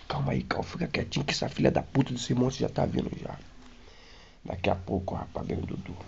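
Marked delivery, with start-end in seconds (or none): 0.1-3.4 retold hiding whisper; hushed whisper. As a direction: whisper so the monster won't hear. Hiding quietly from the monster.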